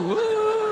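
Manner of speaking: very high falsetto pig noise; high animal yelps; pig squeals. Acted. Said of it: "No, it's a pig".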